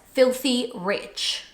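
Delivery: ordinary delivery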